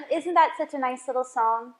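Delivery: usual speaking voice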